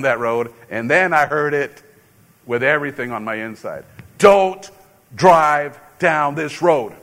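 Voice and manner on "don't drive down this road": loud, slow and firm warning; the voice that stops you, drawn out; commanding inner voice. Retold or imitated. Voice of Holy Spirit commanding.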